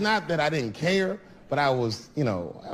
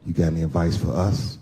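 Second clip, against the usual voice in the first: lower, earnest friend; friend voiced low; 10 semitones lower than usual. Nervous groom confiding in Dave, low voice. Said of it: "He said".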